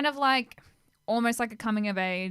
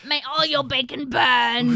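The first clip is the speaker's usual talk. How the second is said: loud, slow, raspy fire demon voice; Calcifer voice; 5 semitones higher, 10 dB louder than usual. Retold or imitated. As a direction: snarl it like a grumpy flame. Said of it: "playing Calcifer".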